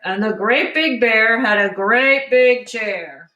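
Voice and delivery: slow, heavy papa bear voice; a hint of a big lumbering bear; lightly voiced big papa bear. A narrator's reading.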